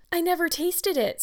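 high-pitched tearful protest; distraught. A narrator's reading. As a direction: protest innocence in a young girl's high voice. Distraught girl defends herself.

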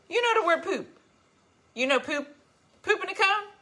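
high playful pet talk; talk to the cat. Real, not acted. Asking the cat about poop, high playful pet voice.

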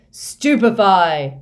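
loud, drawn-out incantation; shouted spell. A narrator's reading. Stunning spell cast at Moody, shouted loud and long. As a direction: hurl the spell with full force.

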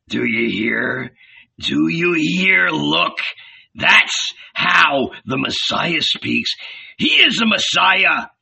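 rising, slow, insistent urging; excited urging. A narrator's reading. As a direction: press him eagerly, pointing at the prophet. Judas excitedly urges Jesus.

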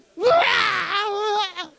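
loud, high hammed-up wail; ugly crying. Shown as a demonstration. Preacher demonstrating the ugly criers to the church, hammed-up bawling. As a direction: bawl noisily in mock ugly crying. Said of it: "There's the ugly criers, you know?".

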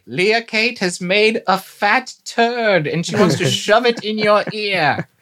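stern archaic proclamation. Pretend. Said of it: "The crier has left the streets".

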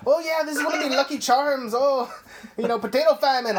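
high, loud cartoon Irish accent; over-the-top Irish accent, played as a joke; mock Irish accent. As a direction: ham up an Irish lilt for laughs. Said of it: "a fucking Irish accent or something".

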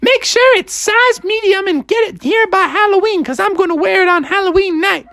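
high falsetto, eager cartoon mother; high-pitched keen mom; Froggy's mom. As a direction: gush in a squeaky mom voice. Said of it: "she's like".

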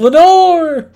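high, loud drawn-out cry; hammed-up wailing shout; retold scream. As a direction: imitate a desperate scream of a lost love's name. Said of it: "and he screams".

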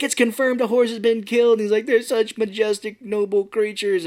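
high-pitched mock grief; overwrought reporter. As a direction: mourn the horse with melodrama. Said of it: "he starts freaking out".

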